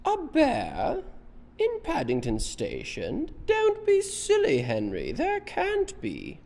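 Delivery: very high, drawn-out disbelief; high-voiced woman. Parent shows children who's talking to Henry, high-voiced. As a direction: do the storybook lady way up high. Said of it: "this is the woman with the high voice".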